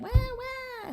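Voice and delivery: very high mock baby wail; a tiny pretend baby cry; doll baby crying. Doll baby wailing at the newcomer, mock cry. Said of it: "said the doll baby".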